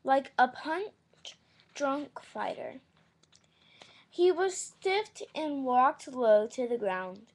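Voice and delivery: loud, slow read-aloud narration; story reading. Acted.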